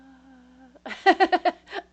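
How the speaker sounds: loud, high nervous laugh. Retold or imitated. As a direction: laugh it off in panic.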